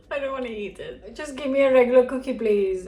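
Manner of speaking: laugh-spoken refusal; amused, squeamish protest; laughing reluctance. Real, not acted. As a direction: giggle through it, not wanting to eat. Faced with an unappetizing bake.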